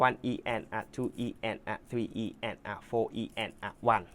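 slow, soft counting of sixteenths. Shown as a demonstration. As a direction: count each subdivision evenly. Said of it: "cabbage สองชั้น".

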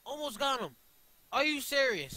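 high, loud outburst of disbelief; high-pitched exasperated shout; frustrated yelling. Real, not acted. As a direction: yell at a near miss. Frustrated near miss in game.

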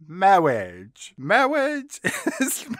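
slow mock-clergyman "Marriage", r turned to w; "Marriage" with a comic w for the r; Peter Cook. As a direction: stretch "Marriage" into "mawwage". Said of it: "when Peter Cook says".

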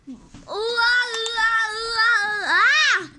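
high, loud ooh-ah alarm siren; imitated siren, high and very loud; siren wail. The alarm goes off.

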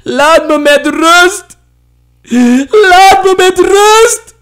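veel hoger en luider, de tweede keer trager; schreeuwen. Acted. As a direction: krijs het wanhopig uit. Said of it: "En ze schreeuwde". (Mofina schreeuwt huilend naar Ma)

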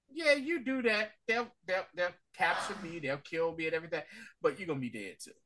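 quiet threat. Retold or imitated.

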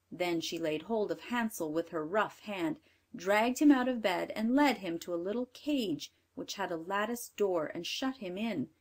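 ordinary delivery, nothing marked

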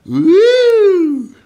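a loud high whoop of joy; excited whoop. Real, not acted. Host whooping to the players at home, high and loud. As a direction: let out a big high woo.

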